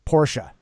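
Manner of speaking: Porsche as two syllables, POR-shuh; correct Porsche pronunciation. Shown as a demonstration. Confirming it for the host, Porsche said POR-shuh. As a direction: sound the final e of Porsche as "uh". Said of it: "correct pronunciation is Porsche".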